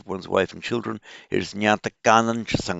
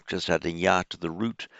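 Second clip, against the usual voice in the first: usual speaking voice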